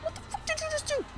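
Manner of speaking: shocked disbelief at the doctor; flabbergasted. Retold or imitated. Confronting the doctor in retelling, shocked and high.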